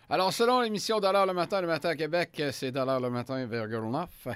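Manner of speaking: upbeat radio host sign-off; punchy morning show announcer. Acted. Radio host wrap-up and station ID. (animateur radio enjoué)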